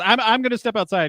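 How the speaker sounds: quick, high nervous stammer; flustered technician. Retold or imitated. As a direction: rush it out like an embarrassed technician. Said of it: "He goes".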